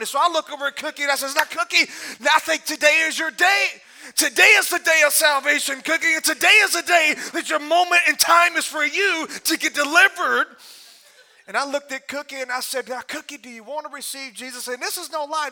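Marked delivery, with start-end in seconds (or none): 1.3-10.5 loud, urgent preacher's appeal; mock-solemn altar call, voice raised. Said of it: "Now's the time for Cookie to respond". 12.9-14.7 softly voiced altar call; hushed, coaxing question to the cat; gentle invitation. Soft altar call to his cat.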